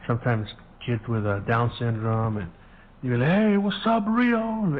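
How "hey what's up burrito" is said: put-on a kid shouting hi across the yard, much higher; high-pitched mock greeting. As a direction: voice jumps way up, friendly call. Kids greeting him, retold.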